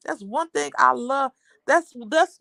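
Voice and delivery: ordinary talk, nothing marked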